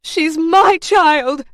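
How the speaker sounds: high, loud, drawn-out scream; anguished high shout; desperate scream. A narrator's reading. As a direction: scream it slowly to protect your child. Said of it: "Charlotte screamed".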